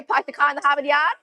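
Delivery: quick Boston accent demo. Shown as a demonstration. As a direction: show off a Boston accent on park the car in the Harvard Yard. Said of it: "That one's more Boston".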